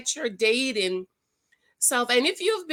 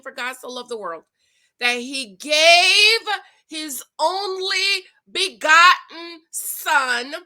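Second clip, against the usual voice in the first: slow, loud, preaching recitation; John 3:16 proclaimed, slow and loud; scripture recitation; 3 semitones higher, 5 dB louder than usual. Real, not acted. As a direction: preach the verse slowly. Quoting John 3:16.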